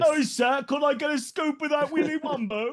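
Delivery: high-pitched London orphan voice; hammed-up Victorian London waif; Dickensian urchin. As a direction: beg like a Dickens street child. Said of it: "Are you a Charles Dickens character?".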